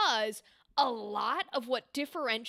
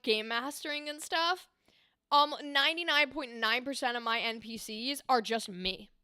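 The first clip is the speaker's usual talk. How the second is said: normal talking voice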